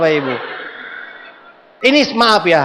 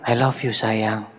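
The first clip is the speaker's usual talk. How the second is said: low, soft and slow, like a husband cooing; a soft lovey-dovey whisper-close voice; tender murmur; 9 semitones lower, 7 dB quieter, slower than usual. Retold or imitated. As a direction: murmur it sweetly to your wife. Husband sweet-talking wife in car.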